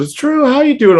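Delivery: high-pitched imitation of an excited brother; excited greeting. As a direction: greet him excitedly, voice shooting way up. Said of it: "he's all excited".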